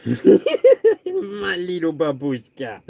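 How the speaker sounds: slow, laughing cooing at the pet. Real, not acted. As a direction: giggle the pet name out slowly.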